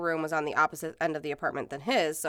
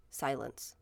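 usual speaking voice

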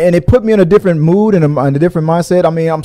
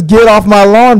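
raised, louder cranky-neighbor shout; grumpy old man; 6 semitones higher, 6 dB louder than usual. Retold or imitated. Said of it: "voice on young Indiana Jones".

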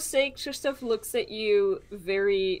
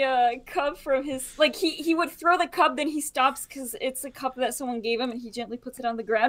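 ordinary talk, nothing marked

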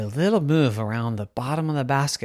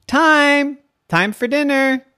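high, loud dinner call; 12 semitones higher, 6 dB louder, slower than usual. Acted. Parent calls the girl in for dinner, calling out. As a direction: holler as if across the yard.

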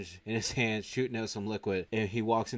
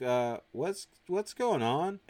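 hesitant question climbing high; puzzled, pitch rising sharply at the end; bewildered question; 7 semitones higher, 3 dB louder, much slower than usual. Retold or imitated. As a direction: act out a confused husband. Husband walks in on stranger.

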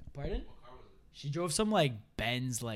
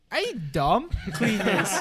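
laughing retort; 5 semitones higher, 5 dB louder, slower than usual. Retold or imitated. Retelling his retort, laughing.

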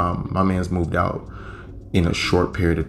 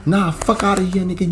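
angry woman yelling; 11 semitones higher than usual. Retold or imitated. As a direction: yell at him like she's been stiffed. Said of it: "She's like".

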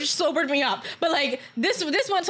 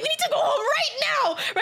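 much higher, urgent plea; panicked insistence; 10 semitones higher, faster than usual. Retold or imitated. Telling the guys to leave, panicked insistence. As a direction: insist in a high, alarmed voice. Repeats warning, escalating in panic.

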